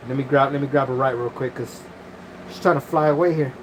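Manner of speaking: usual speaking voice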